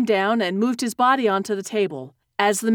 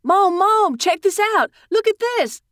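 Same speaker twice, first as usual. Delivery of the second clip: put-on high, raised call of a boy; excited yell; 9 semitones higher, 4 dB louder than usual. A narrator's reading. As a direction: yell for mom to come and look. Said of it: "he yelled".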